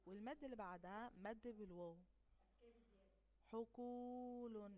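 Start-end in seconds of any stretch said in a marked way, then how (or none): 3.5-4.7 حقول very slow and louder, long و held; slow loud demo of حقول; loud drawn-out model word. Shown as a demonstration. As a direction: sing out حقول slowly. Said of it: "الحرف الممدود هنا القاف".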